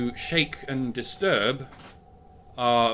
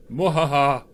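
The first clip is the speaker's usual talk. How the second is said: hammy villain laugh; evil cackle; 3 semitones higher, 5 dB louder than usual. Acted. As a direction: cackle like a panto baddie.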